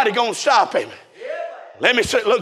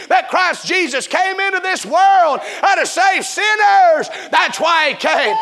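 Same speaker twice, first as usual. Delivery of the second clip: full-force, driving revival sermon; impassioned preaching; 4 semitones higher than usual. Real, not acted. Preacher urging congregation that nobody is like Jesus, impassioned. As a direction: preach it hard, stretching out the big lines.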